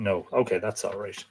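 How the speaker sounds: ordinary talk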